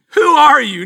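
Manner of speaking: high, loud astonished cry. Retold or imitated. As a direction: belt it out in disbelief. Amazed group reacts.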